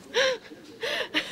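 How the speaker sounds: shrieking, squealing laugh; high laugh. Real, not acted. Retelling the healer's pass, squealing laugh.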